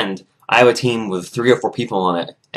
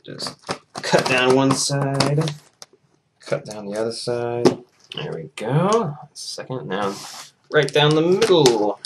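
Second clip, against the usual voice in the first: slow, stretched, higher-pitched words; drawn-out self-narration; 4 semitones higher, slower than usual. Real, not acted. Vlogger cutting open package tape, drawn out. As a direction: stretch each phrase along with the cut.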